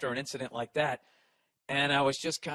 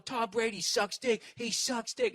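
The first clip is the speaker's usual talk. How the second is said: put-on drunk heckler taunt; 6 semitones higher, slower than usual. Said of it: "this one dude starts going".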